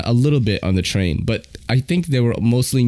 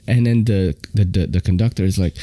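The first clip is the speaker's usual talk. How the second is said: usual speaking voice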